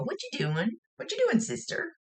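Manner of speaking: high, sing-song pestering question; high child voice; little kid. Retold or imitated. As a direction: tag along and pester like a little sister. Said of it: "She follow you around a lot".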